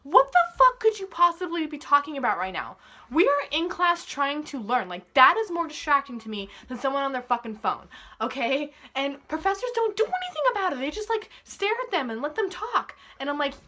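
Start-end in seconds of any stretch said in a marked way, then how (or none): 0.0-10.8 loud, exasperated rant; fired-up venting at full volume. Real, not acted. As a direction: raise your voice and hit the key words hard. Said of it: "this is me just venting".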